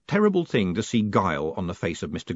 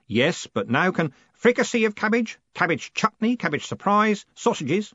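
eager listing; 10 semitones higher than usual. A narrator's reading. Stanley keeps listing.

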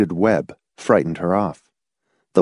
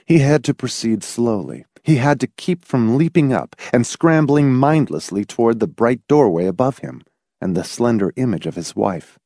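plain reading voice

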